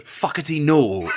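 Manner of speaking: loud, slow, drawn-out mock rage; Malcolm Tucker impression. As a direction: bark it like a furious spin doctor. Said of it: "and he just goes".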